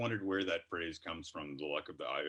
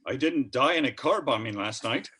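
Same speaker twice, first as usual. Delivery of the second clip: lilting, higher mock-Irish voice; Irish accent; 4 semitones higher, 7 dB louder than usual. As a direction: play a relieved Irishman, louder and higher. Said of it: "you threw in an accent".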